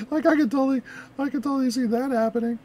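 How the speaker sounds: usual speaking voice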